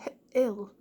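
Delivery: "h-, ill" split after the first sound. Shown as a demonstration. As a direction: break hill into "h-, ill". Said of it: "has a short I".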